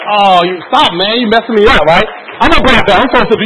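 loud, high blubbering act; hammed-up emotional protest; mock tearful protest. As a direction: play a grown man fighting back tears. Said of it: "I'm like, tears".

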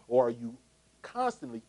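usual speaking voice

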